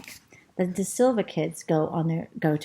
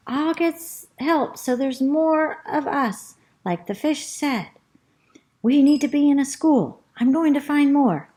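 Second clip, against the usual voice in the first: rat calling; 6 semitones higher, 3 dB louder than usual. Acted. Rat calls up to squirrel.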